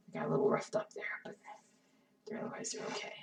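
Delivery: whispered aside. Real, not acted. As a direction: whisper it to yourself.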